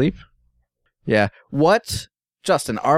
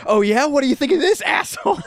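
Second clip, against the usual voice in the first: high mocking taunt; spiteful taunt; 13 semitones higher than usual. To Bill, a high spiteful taunt.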